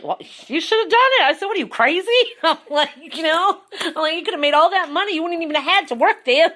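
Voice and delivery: playful exasperated nagging; mock scolding. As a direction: tease him for missing out. Scolding friend for refusing money.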